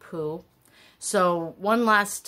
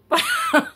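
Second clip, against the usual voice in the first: high, loud laugh bursting out; burst of laughter; 7 dB louder than usual. Real, not acted. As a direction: let a real laugh escape. Laughs at own joke.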